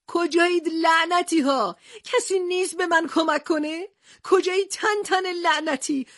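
high desperate shouting into the desert; frightened yelling. Acted. Lost captain shouting for his friends, frightened yell. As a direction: call out alone and scared, pitched high. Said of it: "از ترس و تنهایی فریاد کشید".

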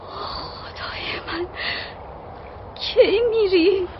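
slow, breathless whisper of shock; stunned whisper. Acted. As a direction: barely breathe it out in dismay. Hears lover goes to war.

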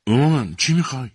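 put-on frightened girl asking her mother. A narrator's reading. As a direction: blurt it out in fright. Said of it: "جین با وحشت سوال کرد".

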